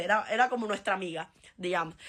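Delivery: ordinary delivery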